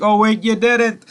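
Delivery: slow, high, drawn-out mocking retort; mock her with a slow, high "you didn't"; sarcastic jab. Recapping the show, he mocks the reply in a slow, high sarcastic voice.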